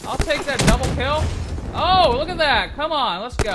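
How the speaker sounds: high, loud celebration; excited hype. Real, not acted. Gamer celebrating a double kill, excited.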